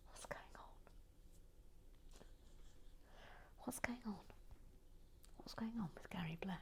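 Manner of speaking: soft baffled whisper; bewildered whisper. Real, not acted. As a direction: whisper it in disbelief.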